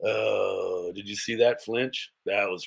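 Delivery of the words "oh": ordinary delivery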